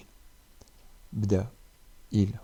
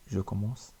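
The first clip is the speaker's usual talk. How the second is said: usual speaking voice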